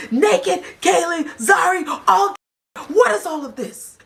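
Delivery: high, emphatic roll-call of names; calling them out. Retold or imitated. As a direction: imitate him summoning each one, pitched high. Acting out calling cast members out.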